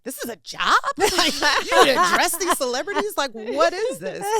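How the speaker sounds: high, loud laughing disbelief; amazed disbelief. Retold or imitated. Teen telling her aunt, laughing amazed disbelief. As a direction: gape at it like a starstruck kid.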